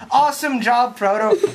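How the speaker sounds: ordinary talk, nothing marked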